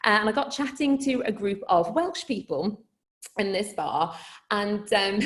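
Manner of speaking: ordinary talk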